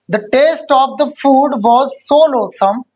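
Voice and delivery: very slow, word by word, for students to write down; teacher dictating at writing speed; slow dictation. Shown as a demonstration. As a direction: spell out the sentence slowly as if the class is copying it. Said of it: "dictating the example again".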